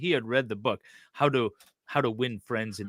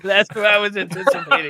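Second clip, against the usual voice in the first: higher, louder words through laughter; laughing; 8 semitones higher, 7 dB louder than usual. Real, not acted. Host admitting what he expected, laughing. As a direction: chuckle while you answer.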